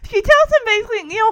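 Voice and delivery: ordinary delivery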